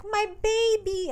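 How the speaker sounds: high, loud, drawn-out coo; exaggerated doting-mother squeal, slowly stretched; gushing mom. Mom hugging her son Luke at his party, gushing.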